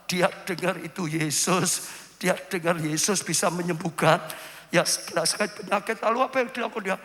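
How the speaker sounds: ordinary delivery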